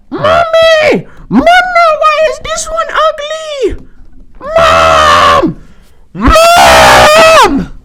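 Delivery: piercing high-pitched mock kid yell; screaming child. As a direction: shriek for mom like an impatient little kid. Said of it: "they're just screaming at the animals".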